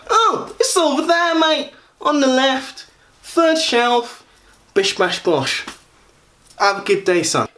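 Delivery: high-pitched, chirpy Essex lad caricature; Gary. Retold or imitated. Shop assistant giving useless directions to a blind customer, mock Essex voice. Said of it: "one or two voices of Gary".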